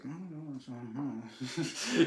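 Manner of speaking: very soft mumbled noises of a hesitant cop; reluctant cop. Retold or imitated. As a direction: imitate a cop quietly declining. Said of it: "the cop's like".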